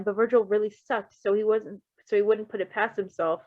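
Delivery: usual speaking voice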